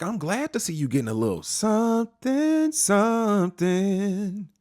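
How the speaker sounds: high, stretched-out teasing voice; sing-song teasing with a long drawn something; teasing son voice. Retold or imitated. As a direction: drag out the last something playfully.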